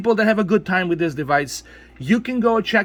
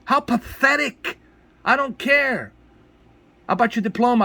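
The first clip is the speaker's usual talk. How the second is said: contempt; 6 semitones higher than usual. Real, not acted. Scolding complainers with contempt.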